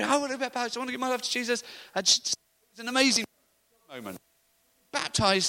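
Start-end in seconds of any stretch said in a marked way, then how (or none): 0.0-1.6 high-pitched imitation of an eager convert; excited girl. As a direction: retell her excited plea, voice raised high. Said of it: "really excited in the water".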